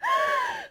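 shrill fake sob; high mock wail. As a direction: let out a high pretend wail. (甲高い嘘泣き)

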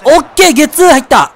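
high, jubilant exclamation; excited cheer. Real, not acted. Pitcher getting his double play, excited cheer. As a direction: celebrate the play out loud.

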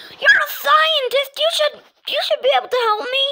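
high, loud, frantic appeal; desperate plea. Acted. Mario begs a scientist for help, desperate and shouting. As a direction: plead at the top of your voice.

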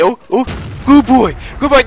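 praising a dog. Pretend. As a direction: praise it like a puppy.

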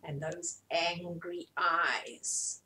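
slow, low hushed voice; hushed spooky. A narrator's reading. Reading a scary bit to children, slow and hushed. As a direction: draw out the menace slowly.

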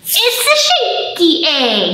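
high, slow, hammed-up amazement; mock surprise. Librarian acting amazed for children at the shaky egg. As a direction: gasp it out wide-eyed for the kids.

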